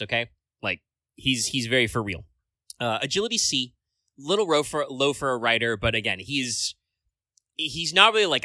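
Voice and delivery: ordinary talk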